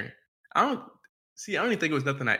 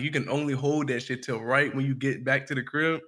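ordinary talk, nothing marked

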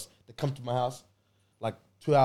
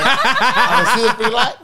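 louder, laughing replay of the sister's "I can see the freaking line"; mock indignant retort; 5 semitones higher, 4 dB louder than usual. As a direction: throw the line back louder, half laughing at it.

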